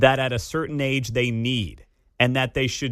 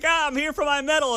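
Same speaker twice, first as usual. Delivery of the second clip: put-on cocky little kid. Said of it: "She's like".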